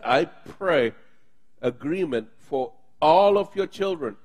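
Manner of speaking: normal talking voice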